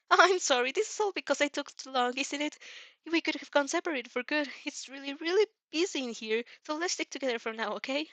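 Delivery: nervous friend fussing over being separated; worried Izuku. Acted. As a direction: apologize in a rush, fretting.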